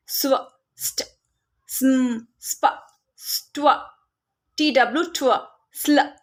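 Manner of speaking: स्व, स्ट, स्म, स्प each hissed then blended as one unit; s blend sounds. Shown as a demonstration. Teacher listing s blends for parents, slow and clear. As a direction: model each s blend slowly. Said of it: "एस blends भी बच्चों को सिखा देते हैं".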